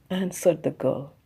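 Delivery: usual speaking voice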